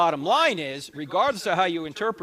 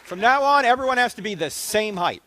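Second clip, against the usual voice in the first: raised, mock-grand proclamation; mock decree; 5 semitones higher, 7 dB louder than usual. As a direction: announce it like a royal decree. Joke after short questioner.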